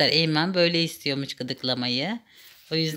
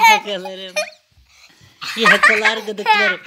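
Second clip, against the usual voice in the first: higher, louder laughing tease; playful laughing threat; 12 semitones higher, 6 dB louder than usual. Real, not acted. Mother playfully chasing child.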